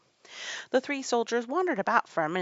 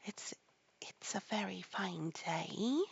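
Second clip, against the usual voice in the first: soft, slow, hesitant opener; timid voice; 6 dB quieter, slower than usual. A narrator's reading. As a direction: venture it timidly, with a stammer. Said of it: "said a timid voice".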